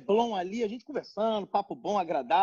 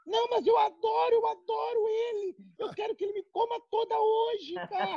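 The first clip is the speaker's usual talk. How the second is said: much higher, giggly woman voice; 11 semitones higher than usual. Pretend. Faking woman on phone.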